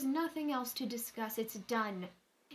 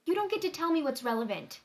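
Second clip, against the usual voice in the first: louder, fast, cutting her off; angry outburst; 3 semitones higher, 8 dB louder, faster than usual. Acted. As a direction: push back hard, voice raised. Heated argument with Danny.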